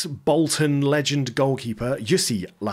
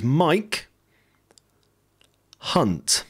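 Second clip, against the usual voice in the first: slow, deliberate, each word spaced out; very slowly; 6 semitones higher than usual. Shown as a demonstration. Saying the player's name slowly for YouTube, drawn out. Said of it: "I'm gonna say his name very slowly".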